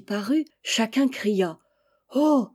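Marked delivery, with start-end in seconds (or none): 2.1-2.5 high, loud gasp of wonder; mother bird marvels at the hatching; surprised oh. Acted. Mother sees her last chick hatch, surprised oh. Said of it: "dit la mère".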